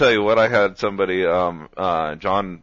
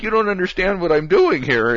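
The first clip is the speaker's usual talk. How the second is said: higher, louder mock complaint; retold indignant protest; 10 semitones higher, 5 dB louder than usual. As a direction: imitate someone upset at being laughed at. Retold friend protests being laughed at.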